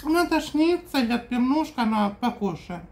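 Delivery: talking with mouth full; speaking between bites; chewing while talking. Real, not acted. Seen 3: eats from the pan, fork to mouth, chewing during the words.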